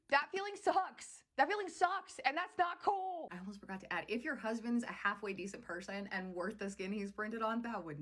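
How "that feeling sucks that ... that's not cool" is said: high, drawn-out emphatic complaint; slow, indignant insistence; emphatic indignation. Real, not acted. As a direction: lean hard and high on each phrase. Indignant on first wife's behalf.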